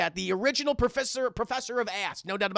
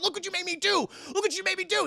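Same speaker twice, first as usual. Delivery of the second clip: high mock reproach; 9 semitones higher than usual. Doctor blames detective after spill.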